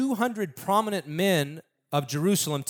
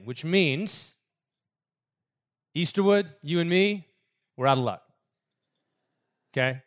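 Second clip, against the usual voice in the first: normal talking voice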